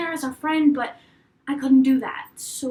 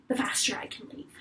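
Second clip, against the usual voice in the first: unvoiced, much softer aside; whispered thought; 3 semitones lower, 9 dB quieter than usual. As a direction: murmur it to yourself, no voice. Inner thought during act.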